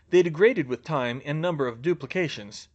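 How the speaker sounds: ordinary delivery, nothing marked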